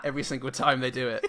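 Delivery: ordinary talk, nothing marked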